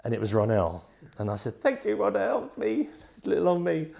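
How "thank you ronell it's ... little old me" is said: small, high-pitched acted voice; high, meek mock-grateful squeak; meek relief. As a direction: play little old me, grateful and high. Meek relieved reply to his rescuer.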